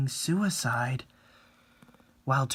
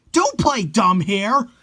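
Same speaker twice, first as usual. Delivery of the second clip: louder, slow furious demand; angry shout; 8 semitones higher, 8 dB louder, slower than usual. Acted. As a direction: bark it at him. Fan confronts the boyfriend.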